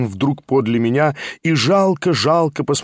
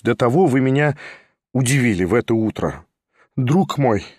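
ordinary delivery

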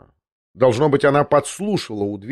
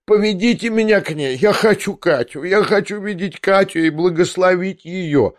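exclaiming; 6 semitones higher than usual. Acted. Old prince straining from bed to see Katya, high eager exclaiming. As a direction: plead to see her at once. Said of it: "восклицал он".

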